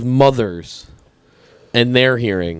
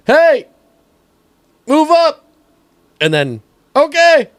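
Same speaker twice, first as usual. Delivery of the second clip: high yelled call across the woods; 5 dB louder than usual. Retold or imitated. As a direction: shout from tree stand to tree stand. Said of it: "pushing deer and being like".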